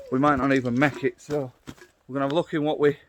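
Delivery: ordinary delivery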